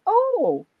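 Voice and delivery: put-on high little-child voice; three-year-old granddaughter. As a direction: imitate a small girl recalling something. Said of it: "my three-year-old granddaughter walked in".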